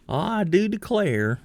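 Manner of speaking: high, slow, hammed-up Southern drawl; genteel Southern accent. Shown as a demonstration. Player showing what North Georgia isn't, in a high, exaggerated Southern accent. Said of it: "southern accent".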